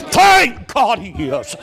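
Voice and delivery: very high, very loud, slow shouted preaching; preaching climax shout. Acted. Preacher at the climax to the church, shouting. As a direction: cry it out at the top of your voice.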